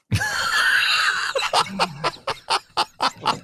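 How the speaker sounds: high, loud breathless shriek of laughter. Real, not acted. Friend cracking up at the Taco Bell joke, shrieking laugh.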